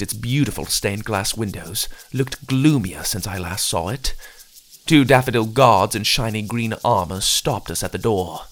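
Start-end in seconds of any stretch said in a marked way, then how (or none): none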